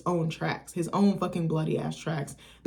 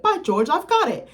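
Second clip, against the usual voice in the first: much higher and louder, a mock mad scientist's eureka; triumphant exclamation; 11 semitones higher, 6 dB louder than usual. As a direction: shout it out like the experiment just worked. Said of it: "he's like".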